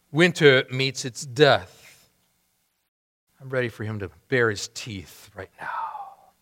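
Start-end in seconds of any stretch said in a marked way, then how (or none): 3.4-6.3 whisper. Real, not acted. Preacher's fervent aside.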